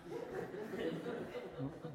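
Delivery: soft laugh. Real, not acted. Laughs at own joke.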